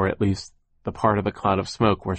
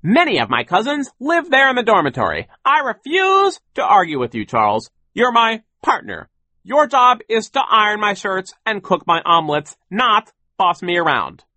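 high, loud, emphatic tirade; blustering, indignant dressing-down; domineering boss; 13 semitones higher, 7 dB louder than usual. A narrator's reading. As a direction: put the partner firmly in place. Sir scolds partner.

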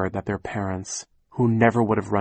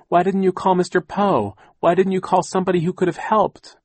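put-on high, loud, insistent child voice; questioning child; 10 semitones higher, 6 dB louder than usual. A narrator's reading. Niece challenging aunt for not seeking help, insistent questioning. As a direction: press the question, pitched high.

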